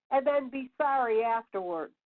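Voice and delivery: normal talking voice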